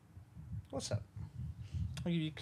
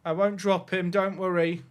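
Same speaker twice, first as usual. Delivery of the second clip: usual speaking voice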